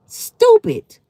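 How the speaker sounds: high, loud one-word put-down; scornful yelp. Real, not acted. Scorning a commenter who asks about abortions, a high loud yelp. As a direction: spit it out in disbelief.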